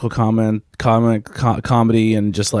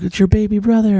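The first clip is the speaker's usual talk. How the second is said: put-on higher sing-song voice to a toddler; high cooing baby talk; 10 semitones higher than usual. Parent showing kid his sibling, high cooing voice. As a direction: coo it like showing off a new baby. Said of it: "and I'm like".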